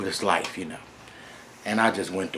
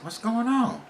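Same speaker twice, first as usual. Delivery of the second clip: high, light questioning voice; a young voice, pitched up, curious; son asking; 12 semitones higher than usual. Retold or imitated. Son asking his angry father, high questioning voice.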